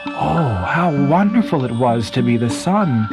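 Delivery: ordinary delivery, nothing marked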